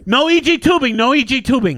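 high, loud, urgent repeat of "No E G tubing"; doctor bursting in, yelling to halt the tube; urgent shouting. Retold or imitated. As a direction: burst in and stop the procedure. Doctor rushing in to stop.

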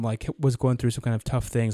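usual speaking voice

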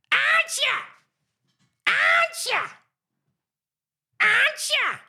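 piercing falsetto repetition; put-on voice. Shown as a demonstration. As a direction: echo the phrase in a very high squawk. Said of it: "Now, that's just one voice".

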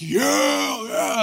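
loud, high shouted yeah; crowd cheer. Retold or imitated. Prisoners cheering at the number eighteen. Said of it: "everyone's like".

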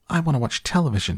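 high, very fast tiny voice; squeaky tantrum. A narrator's reading. Shrunken boy in a tantrum, high squeak. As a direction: squeal the demand in a rush. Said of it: "he squeaked".

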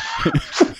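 sudden high laugh; a short squeal of laughter. Real, not acted. Teasing Scott about his accent, high laugh.

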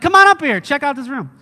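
high, loud call that eases off; excited calling. Acted. Kid inviting Dad to inspect the room, excited holler. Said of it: "Like, you're just excited".

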